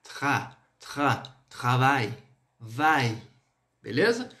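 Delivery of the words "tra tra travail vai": "Tra, tra, travail" with a torn, throaty R; slow syllable drill, "tra" rasped, then "vai". Shown as a demonstration. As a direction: break "travail" into slow pieces, scraping the R. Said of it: "o R é bem rasgado".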